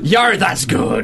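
slow laughing pirate growl. Pretend. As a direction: stretch it out like a pirate. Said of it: "Talk Like a Pirate Day".

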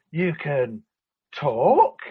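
halting, then a big questioning rise; mock amazement. Imitating cartoon pair discovering each other's speech, mock amazed. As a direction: act out astonished discovery, last word swooping up.

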